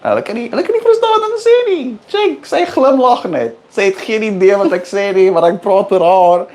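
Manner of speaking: conspiratorial Afrikaans in a fast high voice; secret language chatter. Acted. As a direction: rattle it off like a secret gossip. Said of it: "Speaking in that reverse language again".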